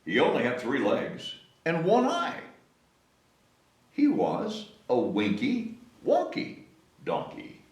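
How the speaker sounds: ordinary delivery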